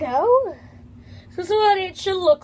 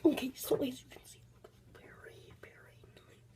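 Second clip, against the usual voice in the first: hushed whisper; 3 semitones lower, 16 dB quieter than usual. Real, not acted. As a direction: whisper it, barely breathing. Sudden whispering while showing slime.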